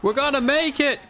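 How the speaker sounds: excited cheer. Real, not acted. Tense escape, cheering on.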